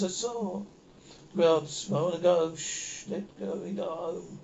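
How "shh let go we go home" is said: slow whispered shush; hushing whisper. Acted. Comforting a scared passenger, whispering slowly. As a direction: soothe them in a hush.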